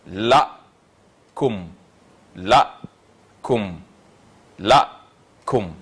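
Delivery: la, pause, koum, repeated evenly; syllable by syllable. Shown as a demonstration. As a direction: sound out la and koum as two syllables. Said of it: "le mot est ici constitué de deux syllabes".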